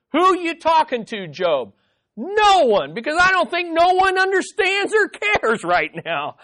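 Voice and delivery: high, raw outburst of anguish; emotional eruption. Acted. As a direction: let it burst out of you, pleading. Preacher voicing Job's outburst.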